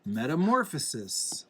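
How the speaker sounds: plain reading voice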